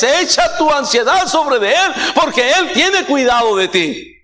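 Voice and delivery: verse proclaimed high and strong. Acted. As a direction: ring out the promise to the church. (versículo proclamado en alto)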